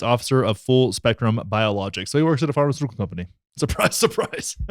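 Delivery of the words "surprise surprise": laughing sarcasm. Real, not acted. Sarcastic reveal of a conflict of interest.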